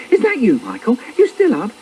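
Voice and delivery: high-pitched, fussing mum's voice; scolding mum. Acted. Mum scolds Michael through the door, high and reproachful.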